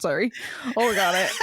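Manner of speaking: ordinary talk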